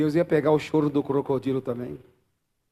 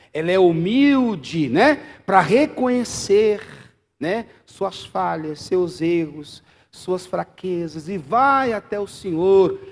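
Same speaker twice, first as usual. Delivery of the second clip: pregação inflamada; 4 semitones higher, 9 dB louder, slower than usual. Acted. Prega com força.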